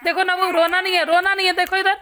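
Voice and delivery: high, loud urgent coaxing. Real, not acted. Mother calls loudly and high over her baby's crying.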